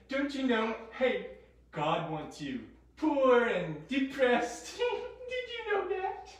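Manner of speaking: soft devil voice rising at the end; lightly voiced devil. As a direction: peddle lies as a hammed-up tempter. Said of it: "the devil tried to tell them that God is small".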